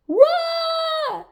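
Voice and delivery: very high, long scream; panicked shout. A narrator's reading. Lion joining Rabbit's flight, high panicked shout. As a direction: scream the warning out.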